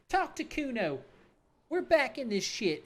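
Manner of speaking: a hint of the high brat; lightly voiced bratty kid. Calmer kid Kuno grudgingly talking to the cop, lightly voiced.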